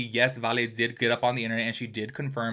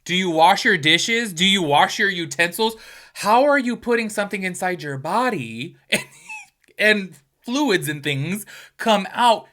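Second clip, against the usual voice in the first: higher and louder, slow rhetorical questions; incredulous scolding; 6 semitones higher, 8 dB louder than usual. Retold or imitated. Questioning a friend who never washed her toy, loud incredulous scolding. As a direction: grill her like she should know better. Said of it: "And I was like".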